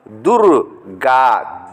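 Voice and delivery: दुर्गा stretched slowly, durga; slow demonstration. Shown as a demonstration. Teacher slowly models the word for students. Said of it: "teacher reading word".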